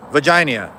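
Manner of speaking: Virginia said as "Vahginia", no R sound; R dropped. Shown as a demonstration. Explaining the town name, Virginia without its R. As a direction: drop the R in Virginia, Boston style. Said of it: "just took the R out of it".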